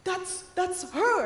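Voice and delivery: slow, loud, high stammer of disbelief; confused disbelief. Retold or imitated. Confused driver pointing out the guest, mock stunned. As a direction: imitate a stunned driver, halting and incredulous. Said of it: "he was so confused".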